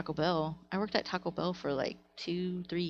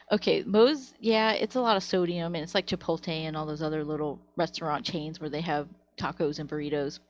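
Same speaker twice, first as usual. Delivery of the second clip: ordinary talk, nothing marked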